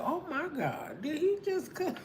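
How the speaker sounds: high, scandalized gasp; shocked churchgoer. Retold or imitated. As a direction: clutch your pearls in a high voice. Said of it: "I said".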